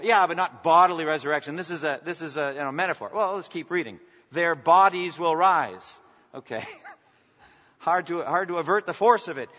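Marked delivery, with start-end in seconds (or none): 4.3-6.0 louder, slow, weighty proclamation; emphatic proclaiming. Shown as a demonstration. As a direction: proclaim it slowly, stressing "bodies". Rereading the verse, now emphatic.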